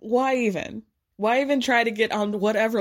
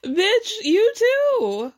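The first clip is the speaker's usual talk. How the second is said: high, slow sassy jab; exasperated drawl; 12 semitones higher, 4 dB louder, much slower than usual. Real, not acted. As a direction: drag it out with attitude. Reacting to reality show scene.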